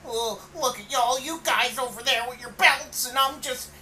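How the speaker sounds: high, loud, mocking whine; mock a sulking wrestler; whiny Roddy impression. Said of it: "and then Roddy's just like".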